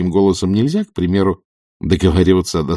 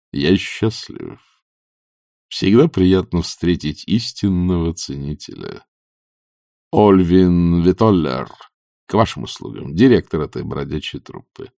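grand honey-voiced actor-manager; 3 semitones lower than usual. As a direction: introduce yourself with unhurried theatrical courtesy, sinking lower.

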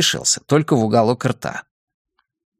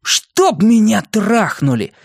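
put-on high, drawn-out boyish exclamation; stretched-out cry of amazement; amazed exclamation; 9 semitones higher, slower than usual. A narrator's reading. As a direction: exclaim it slowly with the pitch pushed up. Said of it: "воскликнул Бенни".

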